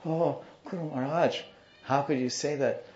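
high, distressed son's voice, acted; imitated trembling son. Trembling son asking guru about his crying mother, distressed. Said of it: "The son was trembling".

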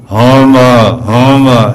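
loud mooing reply of the mother cow; mother cow lowing. Retold or imitated. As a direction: moo back Homa as the cow mother. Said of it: "they talk back and forth".

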